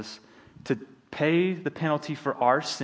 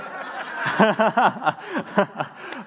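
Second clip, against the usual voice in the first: embarrassed laugh; 8 semitones higher, 3 dB louder than usual. Real, not acted. Embarrassed laugh after mistake.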